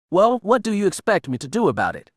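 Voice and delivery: a touch of exasperated demand. A narrator's reading. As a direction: throw the question back hard. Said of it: "Luke demanded".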